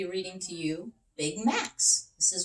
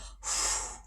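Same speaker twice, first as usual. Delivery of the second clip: blowing into umbrella; 4 dB quieter than usual. Acted. Big Macs blows into umbrella.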